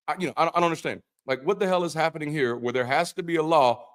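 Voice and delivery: ordinary talk, nothing marked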